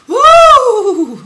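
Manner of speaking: very high squeal into a loud woo; excited squeal and whoop. Real, not acted. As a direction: let out a high, elated whoop. Squeal after I'm like.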